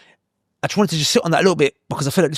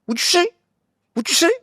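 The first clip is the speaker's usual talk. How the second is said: sharply raised, pushy what'd-you-say; imitating a hostile guy in a lift, much higher; mock confrontational American; 14 semitones higher than usual. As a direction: bark it back much higher like a hostile stranger.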